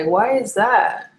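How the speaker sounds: ordinary talk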